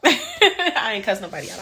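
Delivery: amused, with laughter breaking in; laughing admission. Real, not acted. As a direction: laugh through a playful confession. Laughing while admitting she exaggerated.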